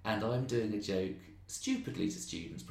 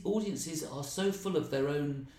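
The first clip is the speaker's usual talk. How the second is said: ordinary talk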